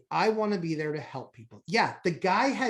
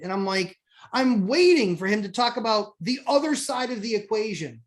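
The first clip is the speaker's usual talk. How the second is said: normal talking voice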